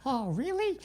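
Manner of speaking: put-on high eager gee-whiz boy; a bright squeaky schoolboy; wholesome 1950s kid. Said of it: "Oh my God".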